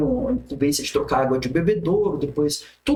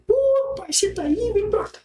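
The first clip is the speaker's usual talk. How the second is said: put-on much higher, bright call to the young man outside; boss calling warmly. Boss spotting the young man waiting outside, friendly high call. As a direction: hail him from the car window like a friendly boss.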